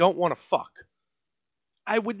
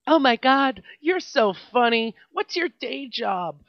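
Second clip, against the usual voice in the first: high, loud gushing customer; mock the fawning diner; a hammed-up gushing woman at the table; gushing customer imitation; 6 semitones higher, 5 dB louder, slower than usual. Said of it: "mocking the customer again".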